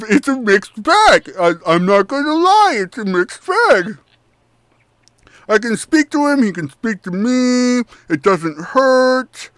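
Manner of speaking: cheerful goblin talking through a mouthful; mouth-full goblin Chucklehead. Acted. Chucklehead explains the maggot in his head, chewing.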